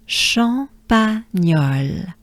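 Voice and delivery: Champagnole drawn out slowly, syllable by syllable; slow model. Shown as a demonstration. Teacher modeling Champagnole slowly for the class. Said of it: "Good breath with me".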